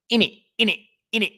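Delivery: "In it, in it, in it" in a high, loud mock slang voice; mocking "innit". Mocking rebellious English youth saying "in it", high loud parody. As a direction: parrot "in it" high and cocky. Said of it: "and be like".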